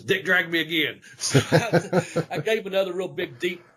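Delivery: real laughter breaking through the words; laughing. Real, not acted. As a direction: tell it while cracking up. Amused retelling, laughing as he talks.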